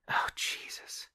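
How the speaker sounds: whispered, breaking down; the tough shell cracks into a whisper. Acted. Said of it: "something break inside her".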